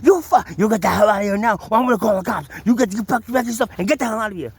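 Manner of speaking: retold manager screaming in his face; angry shout. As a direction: roar him out of the room. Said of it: "he was in my face going like".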